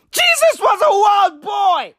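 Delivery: high, loud bellow of the catchphrase; hyped shout. Acted. Comic hyping the crowd with a loud, high bellow. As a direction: roar it to the room like a hype man.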